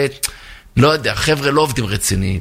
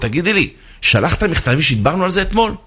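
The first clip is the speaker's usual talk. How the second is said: irritated questioning; 6 semitones higher than usual. Acted. Irritated manager questions his secretary.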